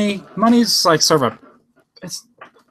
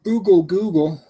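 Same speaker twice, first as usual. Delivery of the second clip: calling out; 5 semitones higher than usual. Real, not acted. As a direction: call the assistant's name to wake it. Calling out to the voice assistant.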